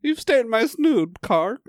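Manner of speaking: high, slow reproach; hammed-up period voice; mock aristocrat scolding. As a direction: scold the servant pompously. Period-drama parody continues.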